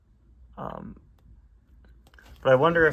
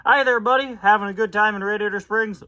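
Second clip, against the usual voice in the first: raised folksy tow truck voice; Mater voice; 9 semitones higher, 7 dB louder than usual. Acted. Reading comic aloud, Mater greeting Finn cheerfully.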